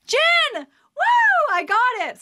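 very high, loud whoop of triumph; excited cheer. Real, not acted. Getting a trivia answer right, she whoops with joy. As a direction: cheer like you won the game. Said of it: "Sorry, I'm so excited".

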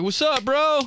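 high-pitched, buddy-buddy hello; hyped bro greeting. Acted. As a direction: greet your best friend with gusto. Acted greeting to male friend.

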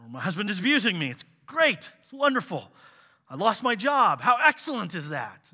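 sarcastic fake delight, pitched high; mock cheerful. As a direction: gush with absurd fake joy. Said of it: "who go".

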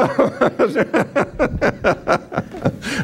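long, high, pulsing laugh; real laughter. Real, not acted. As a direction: laugh it out in a long rattling run. Laughs after teasing the congregation.

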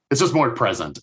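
ordinary talk

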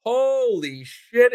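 high, drawn-out exclamation; astonished, slow and high; amazed. Real, not acted. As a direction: stretch it out in real amazement. Reacting to four successes.

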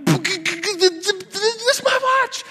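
put-on very high, slow, drawn-out child whine; kid pleading. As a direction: whine it like a first grader whose toy was taken. Kid sees classmate wearing his watch.

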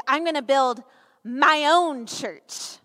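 "my own church" slowed right down, firmly stressed; emphatic. Retold or imitated. Preacher quoting Jesus on building his church, emphatic. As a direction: lean emphatically on "my own church", pausing before it. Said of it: "Jesus says really emphatically".

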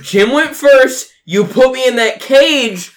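heated protest. Acted. Arguing against the cage.

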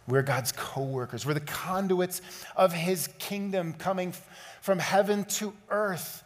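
high, loud, driving sermon voice; rising fervent pulpit call; full-force preaching. Acted. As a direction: preach it hard to the whole room. Closing exhortation.

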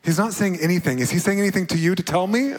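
high, fast desperate plea to a friend; pleading rush. Retold or imitated. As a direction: beg the mentor for an answer. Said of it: "I just kept going".

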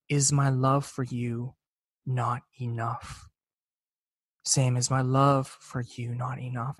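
slow, soft and tender question; gentle divine voice. Retold or imitated. God answering Sam's tearful prayer, slow and tender. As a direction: ask it gently, like a loving father. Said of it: "the thought came in my mind".

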